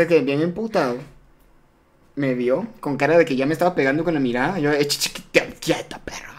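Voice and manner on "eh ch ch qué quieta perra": breathy whisper, barely voiced; thought to himself. Boy thinking while facing his angry father, whisper. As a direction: whisper it under your breath, scared. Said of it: "Eso pensé".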